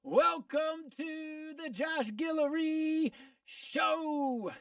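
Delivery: slow, drawn-out showman welcome; announcer voice. Acted. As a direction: boom it out like a host opening the show. Radio show opening announcement.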